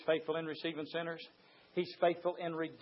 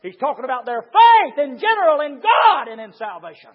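shouted preaching; 7 semitones higher, 19 dB louder than usual. Acted.

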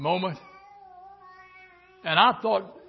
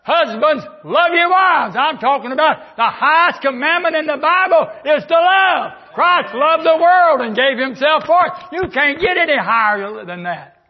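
raised, ringing preacher's cry; preaching at full force; 7 semitones higher, 6 dB louder than usual. Acted. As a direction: preach it out loud to the church.

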